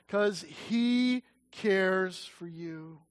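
very slow, gentle assurance; tender emphasis. Real, not acted. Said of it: "slow emphatic answer".

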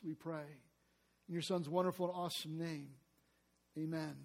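soft, hushed prayer voice. Acted. Pastor leading the church in opening prayer, softly. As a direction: pray it quietly to God.

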